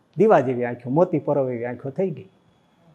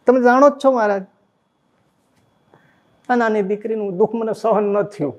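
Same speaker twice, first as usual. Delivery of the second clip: a father begging, voice lifted; pleading father; 6 semitones higher than usual. Retold or imitated.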